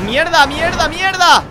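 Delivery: loud, sharp burst of swearing; frustrated cursing. Real, not acted. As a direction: swear loudly as the car crashes. Car crashes into a ditch.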